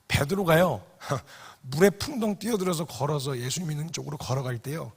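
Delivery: normal talking voice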